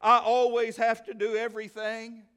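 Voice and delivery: hammed-up self-pity moan; mock self-pity. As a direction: pout it like a martyr. Woman voiced complaining to herself.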